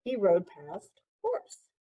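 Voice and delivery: usual speaking voice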